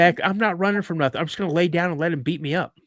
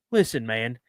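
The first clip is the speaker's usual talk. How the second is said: plain reading voice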